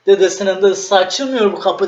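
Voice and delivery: daha yüksek perdeden, yüksek sesle sinirlenerek; kapıya kızıp hocaya patlar gibi; sinirli çıkış. Retold or imitated. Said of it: "nevrim dönmüş". (hocaya dönüp sinirle yakınır, yüksek sesle)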